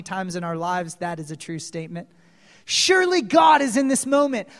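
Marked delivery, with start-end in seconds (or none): 2.6-4.5 loud, raised preaching voice; high, forceful declaration; fervent proclamation. Acted. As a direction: proclaim it to the whole congregation. Preacher proclaims the quote emphatically.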